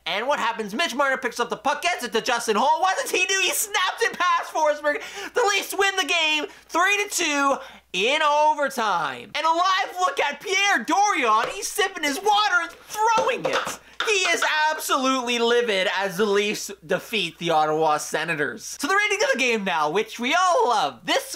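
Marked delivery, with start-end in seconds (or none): 2.8-9.3 excited goal call. Real, not acted. 9.3-18.8 gleeful mockery. Real, not acted. Mocking rival GM.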